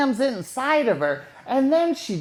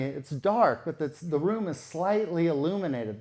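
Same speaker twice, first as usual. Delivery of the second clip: normal talking voice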